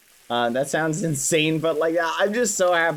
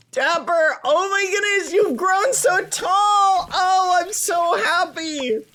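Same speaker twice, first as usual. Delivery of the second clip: higher, excited reunion voice; gushing over grown-up kids; happy character voice; 9 semitones higher than usual. Acted.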